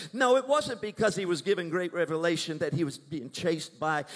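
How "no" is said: ordinary talk, nothing marked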